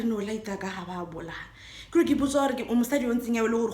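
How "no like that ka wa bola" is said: soft, low mocking repeat of the driver; mocking quiet echo. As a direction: mutter it back under her breath. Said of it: "Ke wa re".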